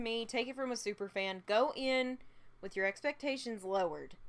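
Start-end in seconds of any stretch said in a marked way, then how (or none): none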